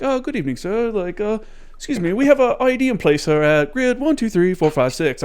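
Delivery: high-pitched polite British caller; British accent. Retold or imitated. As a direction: put on a lilting, high British voice. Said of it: "it's this British dude".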